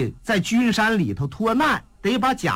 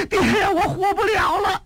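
higher, crying out in despair; wailing daughter; 12 semitones higher than usual. Acted. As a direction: wail to her father. Daughter cries to father.

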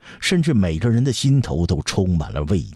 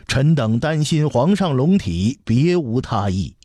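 higher-pitched, anxious protest of loyalty; hasty reassurance; 6 semitones higher than usual. A narrator's reading. Ministers hastily excusing themselves to the emperor, anxious and deferential. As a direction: rush to excuse yourselves, voice lifted high. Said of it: "众人忙道".

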